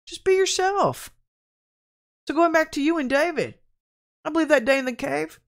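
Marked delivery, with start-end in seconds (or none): 0.1-1.1 high, slow, stretched plea; retold pleading admonishment; exasperated urging. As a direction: urge them high and drawn out. Retold exhortation to church people.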